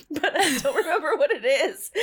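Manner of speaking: laughing through the words. Real, not acted. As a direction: giggle it out. Laughing over forgetting.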